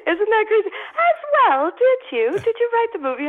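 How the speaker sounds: very high, loud, crying through the words; choked up. Real, not acted. Telling the hosts about the Queen, voice breaking and high. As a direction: fight back tears as you say it. Overcome retelling meeting the Queen.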